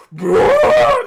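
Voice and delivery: sudden high yelled Bro; character bursts out through the wall with a loud high Bro; shouted bro. Retold or imitated. A man coming out of a wall yells Bro, high and loud. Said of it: "and he goes".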